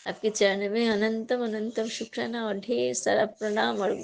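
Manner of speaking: soft, hushed thanks to the guru; devotional prayer. Real, not acted. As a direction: murmur gratitude like a prayer. (धीमी आवाज़ में भक्तिपूर्ण प्रार्थना)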